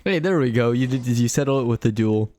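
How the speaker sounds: ordinary delivery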